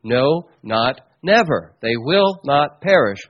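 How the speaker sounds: "no", "not", "never" each set apart and stressed; slow, separated beats on "no, not never", firm "not perish"; very emphatic. Retold or imitated. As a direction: hammer "not" and "never", one word at a time. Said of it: "That's put very emphatically".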